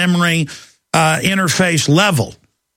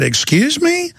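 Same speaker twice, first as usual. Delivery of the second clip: sharp high incredulous question; pitched way up, disbelieving challenge; indignant excuse me; 11 semitones higher, slower than usual. Retold or imitated. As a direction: act stunned and offended. Confronting the woman after insult.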